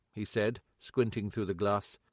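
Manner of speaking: ordinary talk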